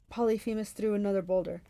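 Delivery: ordinary delivery, nothing marked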